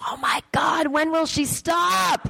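high, slow, squealed exclamation; mock exasperation. Comic marvelling at her own wild day, hammed up. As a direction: wail it in giddy exasperation. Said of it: "I'm like".

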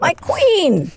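adoring. Retold or imitated. Repeating her adoring reaction.

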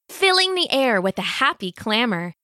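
ordinary delivery

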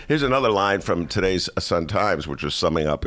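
ordinary talk

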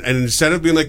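ordinary delivery

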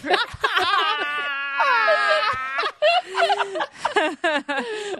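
very high, shrieking laughter; long helpless burst of laughter at being teased; uproarious laugh. Real, not acted. Others tease her about Harvard sweatshirt.

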